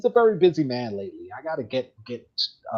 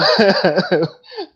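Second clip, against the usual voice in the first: laugh; 8 semitones higher, 9 dB louder than usual. Real, not acted. Laughing about hijinks.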